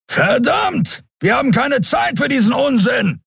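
high, impatient shout; cursing, high-pitched outburst; angry call. A narrator's reading. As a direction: yell with exasperation. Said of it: "rief Kero".

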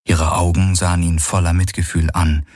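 usual speaking voice

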